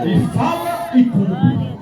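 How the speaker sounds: high, slow, drawn-out shout; preacher's shout. Real, not acted. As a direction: shout it out to rouse the congregation. Preacher answers own question to congregation.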